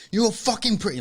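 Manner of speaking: high, loud angry shout in imitation. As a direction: imitate a shop owner blowing up. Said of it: "he's raising his voice".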